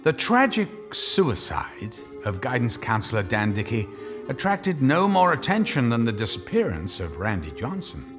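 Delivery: ordinary talk, nothing marked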